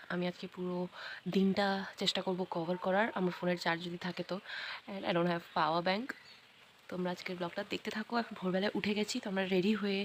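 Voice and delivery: hushed, low-pitched morning vlog; subdued near-murmur; speaking softly. Real, not acted. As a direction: talk very softly so sleepers don't wake. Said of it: "আমি আস্তে কথা বলছি".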